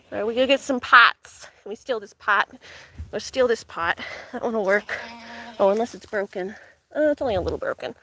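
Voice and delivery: ordinary talk, nothing marked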